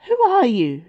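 high, loud challenge; determinedly brave. A narrator's reading. Bella confronting the beast after her scream, determinedly brave. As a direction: demand it loudly, putting on bravery. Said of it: "most definitely brave and not the least quavering".

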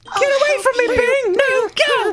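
loud, high panicked yelling; panicked shout. Acted. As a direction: scream it at him in panic.

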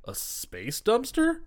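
high, slow disbelieving echo; baffled question. Retold or imitated. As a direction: repeat it like it makes no sense. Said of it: "And Rocky's like".

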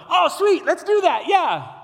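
high, loud, hammed-up cheer; mock enthusiasm. Preacher mocks Peter's eager reply, high and loud. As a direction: fake the eager yes nobody would say. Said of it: "Do you think he's going".

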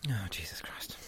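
whispered exasperated aside; unvoiced despairing whisper. Real, not acted. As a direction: breathe it out under your breath. Groaning aside after an insult.

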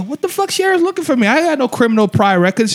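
high, fast, indignant retelling; outraged yell. Retold or imitated. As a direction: blurt it out high and disbelieving. Retold outraged reply to friend.

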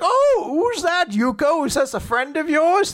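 high, put-on old man voice. Old man passing with his dog.

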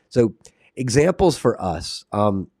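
normal talking voice

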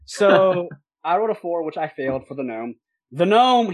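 normal talking voice

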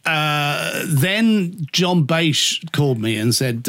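usual speaking voice

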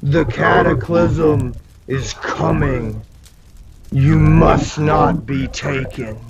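low, slow voice from the fire; deep voice. Acted. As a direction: intone it slowly and low. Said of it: "you hear this deep voice".

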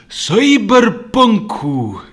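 drawn-out, slow, loud and high reveal; hyped reveal. Acted. Podcaster revealing Keanu's new game, slow loud hyped reveal. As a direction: stretch the title out like a big reveal.

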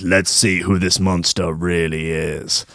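low, slow, menacing reveal; a hint of a dramatic unmasking; the wook. He says.

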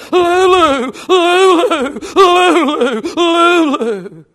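high, falling wail of a name; comic drawn-out crying call; mock screaming wail. Preacher acting a jilted patient, mock screaming wail. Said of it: "they hear somebody screaming".

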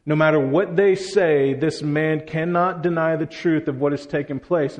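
usual speaking voice

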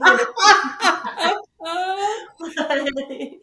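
high, loud burst of laughter. Real, not acted. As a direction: laugh hard at the memory. Laughing at retold son.

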